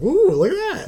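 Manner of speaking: high giggly goofy voice; squeaky delighted dog; Scooby voice. Retold or imitated. Scooby admiring mask.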